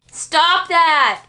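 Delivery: loud, high stern bellow. A narrator's reading. Father bellowing at George to stop, loud bellow. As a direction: bark the order to stop at full volume. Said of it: "bellowed Mister Little".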